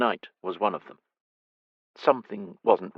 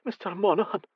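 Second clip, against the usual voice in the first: higher, breathless mother; the startled mother greets him; lightly higher lady saying "Mister Monaghan"; flustered mother; 11 semitones higher than usual. Acted.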